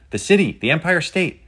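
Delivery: high, loud exclamation; thrilled lookout calling out; excited. A narrator's reading. Riven answers Penny on sighting the city, excited. Riven shouting what he sees.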